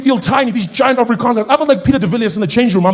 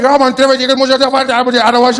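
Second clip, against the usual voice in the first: higher, louder run of mock Afrikaans; gushing Afrikaans fan; 6 semitones higher, 5 dB louder than usual. Happy fan unleashing Afrikaans on him, excited Afrikaans gabble. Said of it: "So he's like".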